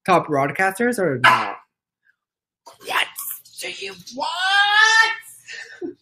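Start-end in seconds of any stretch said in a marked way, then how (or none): 2.9-6.0 very high, hugely drawn-out question; high squeal. Real, not acted. Asking the pet what it wants, squealing.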